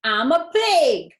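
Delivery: high, loud, slow crow; cried out. A narrator's reading. Avery tossing straw, crying out loud. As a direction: shout it out gleefully. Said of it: "cried Avery".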